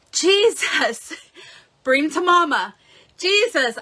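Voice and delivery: high, loud retold cries for help; panicked cry. Mother calling Jesus and her daughter for help, loud panicked cries. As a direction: call out loudly as panic takes hold. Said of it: "it started panicking, and I went".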